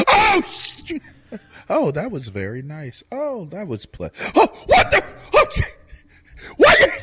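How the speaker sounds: very high, squeaky panicking tortoise; panicky tortoise. Acted. Friend voicing a tortoise's panic for laughs, very high squeak. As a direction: squeal it out like a flustered little tortoise. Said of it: "I just wish you could see through the eyes of a tortoise".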